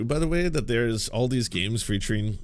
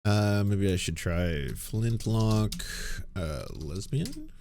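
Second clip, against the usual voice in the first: slow typing voice; slower than usual. Real, not acted. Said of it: "do my research".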